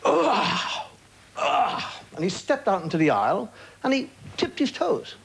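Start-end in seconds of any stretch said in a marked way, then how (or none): 0.1-1.8 high, hammed-up straining grunts; mock the man heaving himself up. Imitating the passenger standing up, hammed-up grunting. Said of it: "He went".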